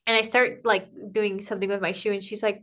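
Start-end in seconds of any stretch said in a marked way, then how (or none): none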